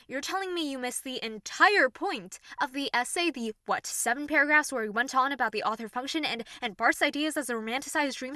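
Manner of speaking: loud, fast, furious lecturing; angry rant. Acted. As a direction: tear into them with contempt.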